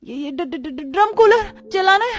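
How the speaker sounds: heavy stuttering, then high blurted excuse; panicked stammer. Acted. Bride told to run the cooler, stammering in panic. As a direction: trip over each first sound in alarm.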